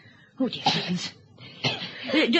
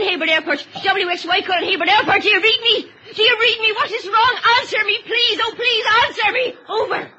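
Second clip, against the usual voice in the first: high, loud, frantic pleading; frantic radio call; 7 semitones higher, 3 dB louder than usual. Acted. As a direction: beg the radio to answer.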